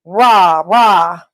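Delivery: restrained imitation of a holler; retold yell. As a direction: imitate a guy yelling, at moderate volume. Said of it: "a guy yells".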